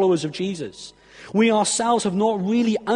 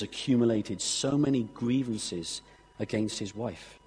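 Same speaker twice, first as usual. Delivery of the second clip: hushed earnest preaching; 7 semitones lower, 6 dB quieter than usual. Acted. Preacher gently urging his church to forgive, hushed. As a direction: draw the congregation in gently, almost confiding. Quiet earnest appeal to congregation.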